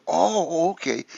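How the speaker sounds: high-pitched retold "oh, I see"; sudden understanding. Acting out the family accepting the explanation, relieved realization. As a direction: act out the penny dropping, voice jumping up.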